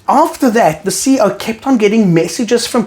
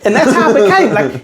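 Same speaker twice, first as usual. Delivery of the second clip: laughing; 3 semitones higher than usual. Real, not acted. Laughing while telling.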